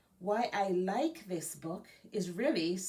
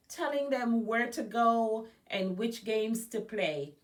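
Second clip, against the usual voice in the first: lively storybook narration, a bit louder; reading to children; 4 semitones higher, 5 dB louder than usual. A narrator's reading. Librarian reading a biography aloud to Girl Scouts, lively storybook voice. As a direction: read it like a picture book to kids. Reads picture book aloud.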